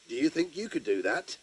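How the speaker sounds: ordinary delivery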